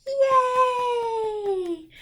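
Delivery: high excited yay; big happy yay; cheer. Acted. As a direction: cheer loudly for the kids. Cheer after the song.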